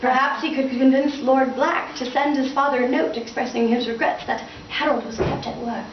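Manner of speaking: ordinary delivery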